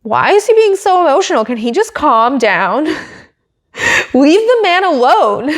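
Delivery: high, fast mock critics scoffing. Vlogger mocking critics of Charles, high and exasperated. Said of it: "they're like".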